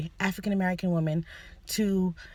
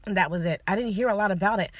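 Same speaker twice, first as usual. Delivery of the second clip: normal talking voice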